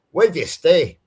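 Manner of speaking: demonstrated Scottish accent. Shown as a demonstration. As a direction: ask it like a Scot. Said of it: "if I was to try to talk Scottish".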